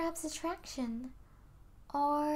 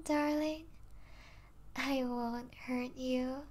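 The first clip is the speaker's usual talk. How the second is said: ordinary delivery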